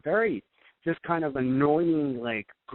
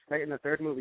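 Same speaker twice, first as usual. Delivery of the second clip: ordinary delivery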